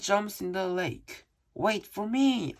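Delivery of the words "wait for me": loud, high yell. A narrator's reading. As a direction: yell after him as he jumps. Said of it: "I yelled".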